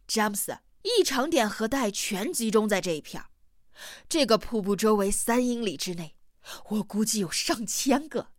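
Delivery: ordinary delivery, nothing marked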